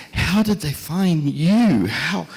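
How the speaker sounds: high, slow, drawn-out wondering question; retold amazement. Grateful taxi driver asking the hospital's architect, amazed. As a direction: marvel at it, pitched well up.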